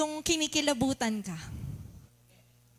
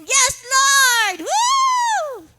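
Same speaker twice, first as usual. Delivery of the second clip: higher, loud whooping cries; hammed-up revival yelling; mock ecstatic shouting; 7 semitones higher, 9 dB louder, slower than usual. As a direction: shout like a church crowd on a high. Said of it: "Nagsisigawan yung mga tao".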